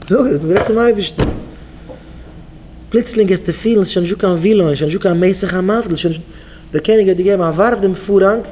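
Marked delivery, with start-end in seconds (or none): none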